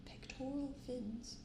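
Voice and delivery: ordinary delivery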